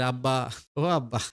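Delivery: ordinary delivery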